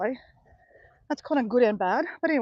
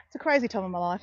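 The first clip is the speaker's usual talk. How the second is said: ordinary talk, nothing marked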